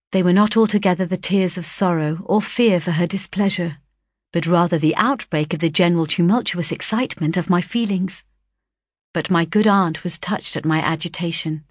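plain reading voice

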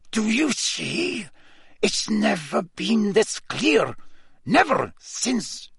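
put-on high, slow, urgent accented voice; tight-lipped Karkaroff. A narrator's reading. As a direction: press urgently through barely moving lips. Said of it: "still making every effort not to move his lips".

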